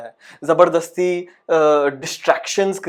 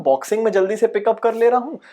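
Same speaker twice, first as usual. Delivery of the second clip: usual speaking voice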